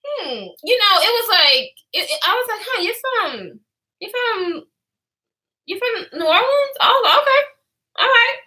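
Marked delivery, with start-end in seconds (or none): 2.6-8.4 mock doubtful. Acts out her own doubtful reaction.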